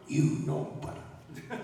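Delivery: slow, voiceless, softened reply; Jimmy Snuka. Retold or imitated. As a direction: imitate a wrestler's hushed stock answer. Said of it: "Jimmy Snuka, like".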